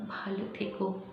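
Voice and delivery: soft, pained goodbye; quietly heartbroken, recited; hurt farewell. A narrator's reading. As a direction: let it drop almost to nothing, hurt and betrayed. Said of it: "কষ্টে, বিশ্বাসভঙ্গের যন্ত্রণায়".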